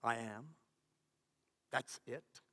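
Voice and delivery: soft, low and unhurried; a low voice, hard to hear; faint voice. A narrator's reading. Faint voice reaching someone straining to hear it, soft and low. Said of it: "a voice so faint".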